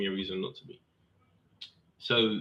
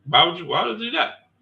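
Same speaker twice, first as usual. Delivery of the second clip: louder, higher disbelief; incredulous retort; 8 semitones higher, 5 dB louder than usual. Retold or imitated. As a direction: push back in disbelief. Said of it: "I was like".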